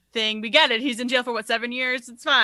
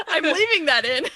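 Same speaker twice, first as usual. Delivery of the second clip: high, louder, through laughter; laughing; 11 semitones higher, 6 dB louder than usual. Real, not acted. Podcaster keeping the botched accent in, laughing. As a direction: barely get it out while cracking up. Cracking up after the accent flop.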